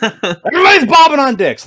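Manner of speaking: high, loud comic holler; mock shout. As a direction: shout it out as a silly announcement. Shouted joke to end the tangent.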